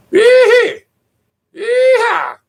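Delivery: very high, loud falsetto yeehaw. Acted. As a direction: whoop it up like a mock cowboy. Mock hick whooping.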